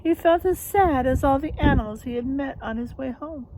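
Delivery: plain reading voice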